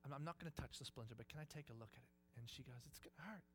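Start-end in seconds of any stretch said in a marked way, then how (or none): 0.1-2.4 soft, quiet coaxing; dad gently coaxing his daughter on his lap; gentle talking. Retold or imitated. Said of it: "start talking gently to her". 2.8-3.4 quiet worry. Retold or imitated. Calmed child answers quietly.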